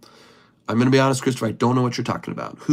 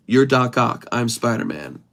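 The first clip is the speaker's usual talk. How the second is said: ordinary delivery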